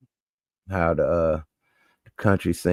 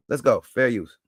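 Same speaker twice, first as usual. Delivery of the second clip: usual speaking voice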